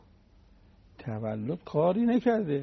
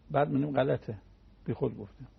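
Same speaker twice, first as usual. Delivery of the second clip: normal talking voice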